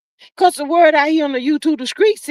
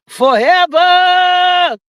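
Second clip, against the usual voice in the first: drawn-out shout; 3 semitones higher, 3 dB louder, much slower than usual. Acted. Reading a viewer's comment aloud.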